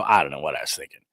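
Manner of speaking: low, softened imagined admission; hushed, low mock regret; mock sheepish confession. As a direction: drop down low, imitating a sheepish man. Imagined sheepish confession after trance.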